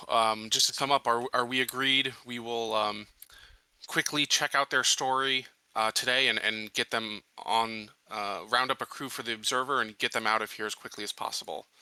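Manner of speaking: ordinary delivery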